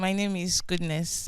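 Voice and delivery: soft and hard to hear; too quiet at first. Real, not acted. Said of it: "Can you speak up, please?".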